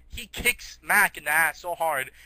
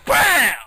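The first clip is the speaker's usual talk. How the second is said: loud, high explosive "bam"; punch sound effect; 9 semitones higher, 11 dB louder than usual. Retold or imitated. Shag punching Mac to Brazil, loud impact noise. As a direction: burst it out like a cartoon punch.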